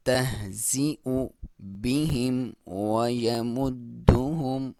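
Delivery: slow, drawn-out tajweed chant; slow Quran recitation. Shown as a demonstration. Teacher reciting the verse for students, slow and drawn out. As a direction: recite it slowly, stretching each vowel. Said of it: "वाव को होंठों की गोलाई से".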